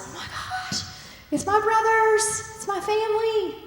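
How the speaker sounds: as if seeing lost family suddenly; astonished realization. Retold or imitated. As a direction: Joseph recognizing his brothers, astonished. Joseph recognizes his brothers.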